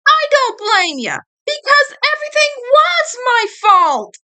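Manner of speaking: high, tearful yell of self-blame; crying shout. Acted. Eddie sobbing, blaming himself.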